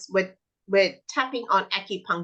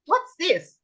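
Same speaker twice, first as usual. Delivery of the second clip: put-on high, bright question to a child; teacher quiz voice; 11 semitones higher, 4 dB louder than usual. Shown as a demonstration. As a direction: ask it like quizzing a small child. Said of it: "I'll say".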